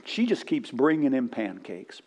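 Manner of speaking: ordinary talk, nothing marked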